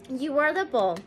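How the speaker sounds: usual speaking voice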